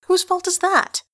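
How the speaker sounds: high, loud angry snap; sharp snap. Acted. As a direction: bite back the question sharply. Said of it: "Jehan snaps".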